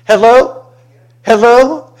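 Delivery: loud, high rising call. Real, not acted. Pastor prompting the congregation, loud and rising. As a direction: demand an answer from the room. Preacher calling out for response.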